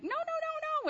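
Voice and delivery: put-on very high, loud childish "no, no, no"; toddler refusing. Three-year-old refusing his mother in meltdown, high squeal.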